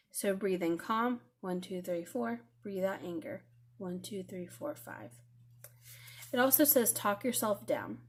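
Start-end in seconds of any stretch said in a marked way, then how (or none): 0.1-5.1 soft counting that fades to a whisper; guided breathing count. Shown as a demonstration. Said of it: "So a little breathing exercise".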